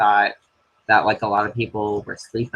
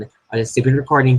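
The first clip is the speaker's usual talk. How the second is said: ordinary talk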